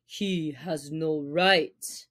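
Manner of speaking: low, slow, growled protest; angry. Acted. Father Wolf angrily answers the jackal, low and slow. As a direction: bristle at the news, low and slow. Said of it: "Father Wolf began angrily".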